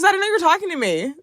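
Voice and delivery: high, fast and giggly; squeaky flustered apology; embarrassed excitement. Retold or imitated. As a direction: blurt it out, caught off guard. Laughing retelling of own mix-up.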